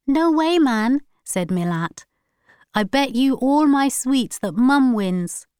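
put-on a boy boasting a bet; other twin's voice. A narrator's reading. Second twin betting on mum, cocky child.

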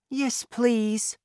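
put-on high, childlike polite reply; light high child voice; boy's voice. A narrator's reading. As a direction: answer as a small boy, high-pitched. Narrator voices the boy.

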